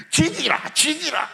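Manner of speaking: light Gyeongsang dialect jab, repeated; mock Gyeongsang quarrel. Pastor mimicking feuding Gyeongsang church members, light Gyeongsang dialect. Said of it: "경상도 말로 싸우는 거예요".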